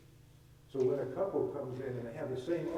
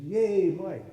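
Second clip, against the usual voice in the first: louder and higher cheer for Mike; 6 semitones higher, 10 dB louder than usual. Retold or imitated. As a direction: cheer him on, bright and loud. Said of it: "And she said".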